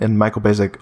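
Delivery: ordinary talk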